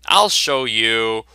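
slow menacing threat. Acted. Comic character threatens slowly in a put-on menacing voice.

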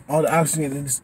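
ordinary talk

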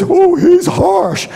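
high, slow whiny mock gasp; a lightly hammed-up scandalized churchgoer; mock complaint. Churchgoer scandalized by a harsh pastor, mock whine. Mimicking people judging pastor.

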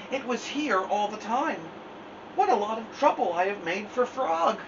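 realizing his mistake; rueful Toad. Acted.

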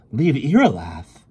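incredulous outcry; shocked question. A narrator's reading. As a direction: blurt it out, appalled. Heroine appalled by the demand.

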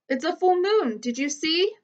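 calling out. A narrator's reading. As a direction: share the news across the dark. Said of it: "I call".

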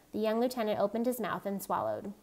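ordinary delivery, nothing marked

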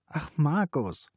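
slow, high weary reply; listless, drawn-out reproach. A narrator's reading. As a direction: answer with tired reluctance, drawing it out. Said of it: "Sie fühlte sich müde und vollkommen lustlos".

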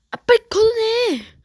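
high, loud, pleading yell; desperate shout. Real, not acted. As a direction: scream at the defender. Player yells to clear ball.

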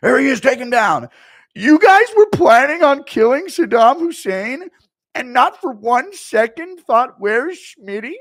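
high, very loud indignant rant; Schmitty rant. Retold or imitated. Streamer as Schmitty scolding partygoers, loud high rant. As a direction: yell it like a wounded ego.

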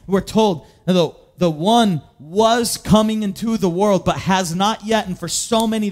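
ordinary delivery

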